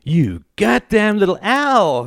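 high, drawn-out outburst of rage; exasperated wife exploding; furious cry. A narrator's reading. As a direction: cry out in outrage, pitch soaring. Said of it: "cried Greta, jumping up".

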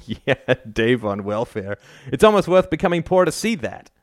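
grinning. A narrator's reading. Al joking about Dave on welfare, amused and grinning. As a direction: say it through an amused grin, rising in pitch. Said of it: "Al grinned".

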